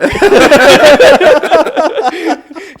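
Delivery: long high laughing fit; can't stop laughing. Real, not acted.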